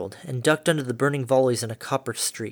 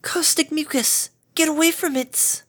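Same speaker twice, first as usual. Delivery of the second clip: timid squeak. Acted. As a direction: squeak out the warning, scared and small. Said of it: "managed to squeak forth".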